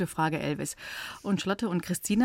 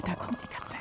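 whispering; 10 dB quieter than usual. Pretend. Playing cats in the dark.

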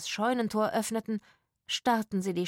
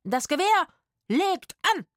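high, loud drill order; shouted military command; 10 semitones higher, 5 dB louder than usual. A narrator's reading. Rufus bluffing a troop into position, shouted military command. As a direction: fire out each order separately.

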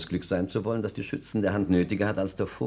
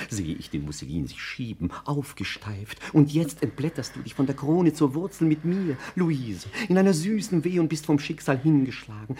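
lyrical, swelling declaration of love; exalted love declamation; 5 semitones higher than usual. Acted. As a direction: declaim like an enraptured poet. Poet declaims his love.

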